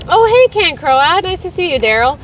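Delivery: ordinary delivery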